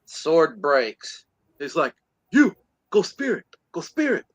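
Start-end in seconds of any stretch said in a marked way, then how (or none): 2.3-4.2 nervous order. Retold or imitated. Acts out ordering another man forward.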